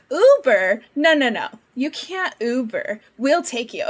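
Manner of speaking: imitating an insistent Minnesotan; Minnesota accent. Minnesota lady refusing to let traveler Uber, Minnesota accent. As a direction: insist kindly with Minnesota vowels. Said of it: "straight up Minnesota, like, hospitality".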